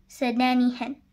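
ordinary delivery

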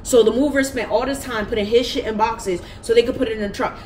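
normal talking voice

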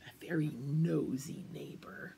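a secretive stage whisper about the neighbor; whispered aside. A narrator's reading. Storyteller whispering to the kids, a sly whispered aside. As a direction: lean in and whisper it like gossip. Narrator's gossipy aside to children.

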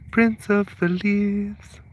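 slow, soft, drawn-out proclamation; mock grand title. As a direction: announce it like royalty, slowly. Announcing cat's grand title.

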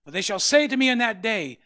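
loud, high preaching of scripture; full-force sermon shout. Acted. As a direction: thunder the verse out to the church.

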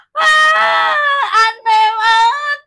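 high, loud, slow shocked cry. Real, not acted. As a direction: wail at the bad card. Drawing chili penalty card.

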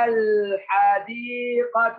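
الحديقة drawn out with a clearly sounded L; slow teacher's demo of الحديقة with audible L; lam pronounced. Shown as a demonstration. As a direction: stretch الحديقة, voicing the lam before the ح. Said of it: "نطقنا اللام".

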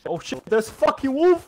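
high, loud cry of alarm; alarmed shout. Real, not acted. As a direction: yell a warning about sudden danger. Wolf attacks suddenly.

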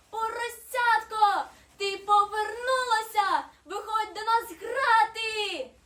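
high, loud, drawn-out snowflake call; ringing high invitation to play. A narrator's reading. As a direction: shout a joyful welcome slowly. Snowflake calls to returned piglet.

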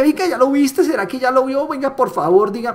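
mock needy nagging, pitched high; high, whiny mock pester; pushy nagging seller. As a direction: ham up a desperate salesman begging for an answer. Mocking a pushy prospector.

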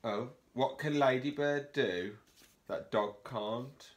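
ordinary delivery

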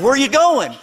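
high, loud imitation of a worried parent; hammed-up dad calling after his child; questioning dad. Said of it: "My dad's going".

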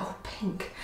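breathy whispered delight; whispering. Retold or imitated. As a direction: whisper it to yourself, thrilled. Spots pink pot, whispered reaction.

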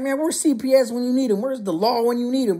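high-pitched pleading complaint; hammed-up outraged whine; mock indignant protest. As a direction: play a kid crying out for rescue. Child protesting his spanking, retold.

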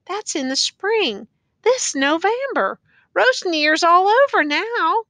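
high, slow, drawn-out telling; insistent correction. A narrator's reading. Old woman correcting prisoner in dialogue.

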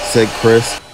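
ordinary delivery, nothing marked

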